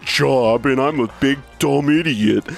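slow, mocking impression of a dopey co-host; Rick. Host mocking his co-host to his face, slow dopey impression. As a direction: drag it out as a dumb-guy caricature. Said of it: "my best impression of you, Rick".

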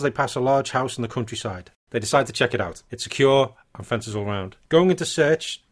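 ordinary talk

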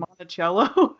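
"Monticello" with a CH sound in the middle; Italian-style pronunciation. Shown as a demonstration. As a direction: say "Monticello" as mon-ti-CHEL-lo. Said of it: "is it spelled the same way".

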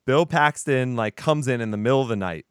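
ordinary delivery

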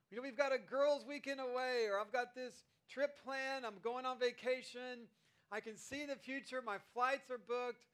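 bubbling over about the trip; eager plans. Acted. Preacher playing an eager traveller, excited.

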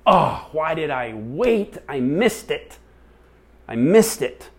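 high, drawn-out wail of regret; loud, high-pitched self-reproach; dismayed lament. Retold or imitated. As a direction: agonize aloud over a missed chance. Retells her upset cry to God.

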